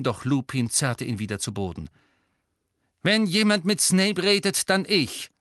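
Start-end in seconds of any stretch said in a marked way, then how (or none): 3.0-5.3 laut, hoch und bestimmt; entschieden. A narrator's reading. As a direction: keinen Widerspruch zulassen, Stimme heben. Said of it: "meinte er entschieden". (mit Entschiedenheit)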